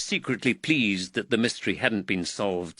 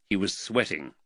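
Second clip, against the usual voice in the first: ordinary delivery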